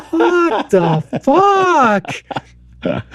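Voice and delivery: very high, drawn-out swoop through laughter; thrilled little kid. Retold or imitated. Repeating the daughter's swearing in the same tone, thrilled. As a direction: imitate a toddler's awed delight, stretched long. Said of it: "same tone".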